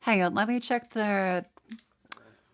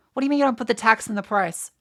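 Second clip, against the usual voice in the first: louder, rapid outraged question; fast angry rant; 4 dB louder, faster than usual. Real, not acted. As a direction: fire it off in fury. Ranting about American pricing.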